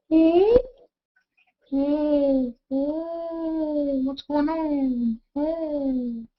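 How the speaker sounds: high, hammed-up bewildered oh; mock surprise. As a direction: play at being baffled, slowly. Filter reacting on camera.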